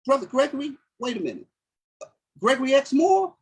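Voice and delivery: imitating Sister Lisa. Teller voices Sister Lisa's surprise.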